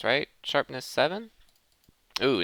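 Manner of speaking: usual speaking voice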